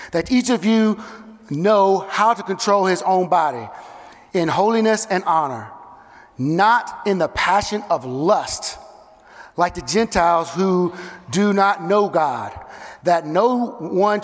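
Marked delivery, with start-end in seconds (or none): none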